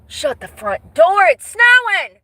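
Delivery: rising excited surprise at the snow; surprised exclamation. Real, not acted. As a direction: blurt it out, delighted and amazed.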